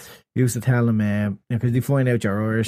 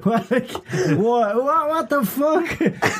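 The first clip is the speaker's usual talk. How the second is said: high, slow, laughing disbelief; mock baffled American; 10 semitones higher, much slower than usual. Mocking an American gamer's confusion, high laughing disbelief. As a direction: play a stunned gamer, drawn out.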